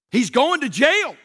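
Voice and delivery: officer hammers out the verdict, loud and slow; slow emphatic trooper. Retold or imitated. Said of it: "He said".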